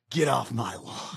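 lightly voiced gruff old man, slow; a hint of an old cowboy warning; Clint Eastwood impression. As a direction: drag it out like a grumpy old movie star. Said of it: "what is Clint Eastwood say?".

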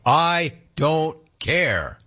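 "I... don't... care", word by word; slow, spaced-out quote for emphasis; emphatic retold quote. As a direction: draw out each word of "I don't care" very slowly. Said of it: "He said it".

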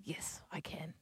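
whispered reply to her daughter; soft whispered yes. Retold or imitated. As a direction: breathe it, barely voiced. Mum answering daughter, whispered.

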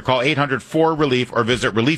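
punchy product pitch, stressing the name; ad read. Acted. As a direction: read it like a radio spot. Host reads sponsor ad.

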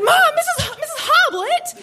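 very high squealed objection; outraged protest. Retold or imitated. Student protesting mom's detention, outraged squeal. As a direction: squeal in protest, correcting yourself midway.

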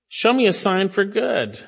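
as if putting God to the test with a fleece. Retold or imitated. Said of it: "Some say David's testing God".

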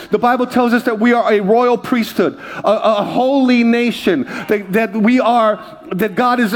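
rising, fervent preacher's cadence; fervent sermon build; full preaching. Real, not acted. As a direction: preach it out to the congregation, climbing.

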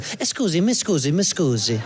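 lightly put-on Italian accent. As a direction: politely ask a stranger with an Italian accent. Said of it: "cute little Italian couple that's like".

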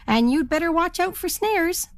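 high-pitched hen warning; warning spruce hen. A narrator's reading. Spruce hen warns the turkey, high voice. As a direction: pitch it right up to warn.

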